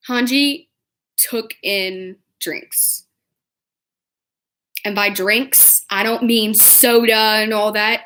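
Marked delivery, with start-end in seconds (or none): none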